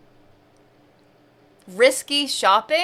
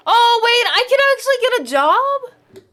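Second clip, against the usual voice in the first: high, loud surprised exclamation; sudden loud discovery; 11 semitones higher, 7 dB louder than usual. Real, not acted. Discovering jobs in the game.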